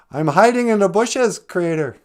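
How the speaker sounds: high, loud answer; acted calling back. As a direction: holler back from a hiding place. Hidden Adam answers God's call.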